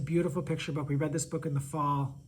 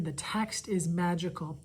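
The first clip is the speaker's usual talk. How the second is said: ordinary delivery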